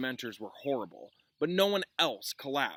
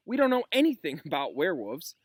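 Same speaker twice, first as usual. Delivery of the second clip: lightly voiced whiny student; 4 semitones higher, 6 dB louder than usual.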